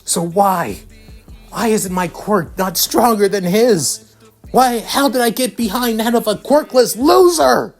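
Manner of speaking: anguished, high-pitched outburst; Bakugo. A narrator's reading. Bakugo learns Izuku won and beat him, anguished outburst. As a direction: build from stunned disbelief to a high, wailing rage. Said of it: "as tears continue to slip".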